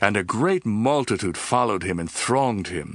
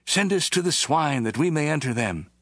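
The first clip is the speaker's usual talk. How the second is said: raised pleading tone; plead, pitched higher; begging; 7 semitones higher than usual. A narrator's reading. Demons begging Jesus, higher pleading voice. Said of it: "all the demons begged him".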